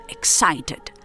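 usual speaking voice

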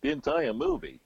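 high, loud disbelieving question; incredulous echo. Acted. Guest repeats the host's claim about the movie, incredulous. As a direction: shoot it back high, as if astonished.

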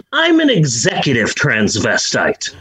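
a hint of Eddie Izzard, low and dry; deadpan the famous line lightly; Eddie Izzard quote. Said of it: "I would go more Eddie Izzard".

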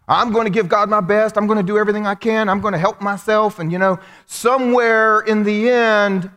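self-reliant determination. Acted. Preacher voices a self-help theology, high determined delivery. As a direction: declare it like a determined do-it-yourselfer. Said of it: "many of us have that kind of theology".

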